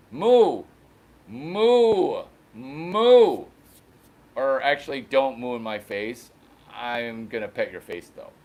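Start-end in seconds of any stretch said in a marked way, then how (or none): 0.1-3.5 mock cow moo.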